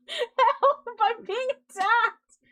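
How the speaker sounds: high, laughing mock panic; hammed-up cry for help through laughter. Streamer pretends her cat attacks her, laughing mock panic.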